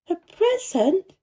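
put-on high, slow rising girl's question; astonished exclamation. A narrator's reading. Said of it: "exclaimed Mistress Mary".

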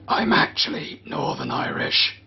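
low whispered confession; whispering. Real, not acted. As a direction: whisper it like a secret aside. Confessing aside after apology.

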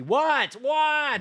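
high, rising-in-pitch yelps of "what"; incredulous shout. Real, not acted. Streamer reacts to chat in disbelief, yelping.

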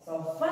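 Sofá with a long held FÁ; stress on last syllable. Shown as a demonstration. Teacher showing which syllable of Sofá is strongest, final syllable stressed. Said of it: "a mais forte é o Fá".

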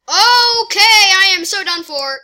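high, loud wail of doom; loud dismay. Real, not acted. Gamer taking a heavy hit, loud high dismay. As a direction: cry out that you're beaten.

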